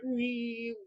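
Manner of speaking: high, drawn-out "wee"; imitated silly noise. Mimicking the little dwarf guy, high silly noise. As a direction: squeal a thin, held mock noise. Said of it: "He's just going like".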